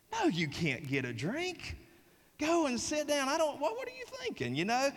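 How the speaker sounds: mock incredulous teacher, pitch climbing high; teacher telling a student to sit down, voice climbing in disbelief; exasperated teacher. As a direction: brush her off in disbelief. Teacher refusing puzzled student.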